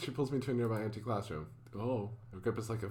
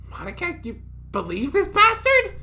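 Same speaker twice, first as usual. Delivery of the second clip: very high, loud outraged outburst; angry shout; 13 dB louder than usual. Acted. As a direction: yell it to her in disbelief. Angry at him.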